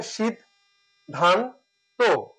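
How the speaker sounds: সিদ, ধান, তো with a pause after each; correct syllable pronunciation. Shown as a demonstration. Teacher giving students the correct sound, সিদ, ধান, তো separated. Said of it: "সঠিক উচ্চারণ হচ্ছে".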